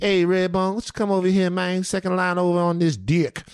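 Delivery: high, crooning New Orleans flirt; smooth New Orleans pickup line. Retold or imitated. Singer chatting up a woman, New Orleans crooning flirt. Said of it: "It was the accent".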